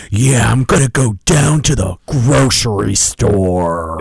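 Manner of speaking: put-on slow American accent with hard R's, like an action hero; over-the-top American accent. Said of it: "sounds like an action movie hero".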